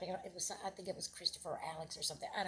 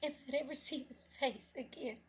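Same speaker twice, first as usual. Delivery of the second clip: high, loud, emotional cry from the heart; tearful outpouring; 7 semitones higher, 7 dB louder than usual. Retold or imitated. Mother not knowing if son was alive, tearful. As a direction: let the anguish swell, near tears.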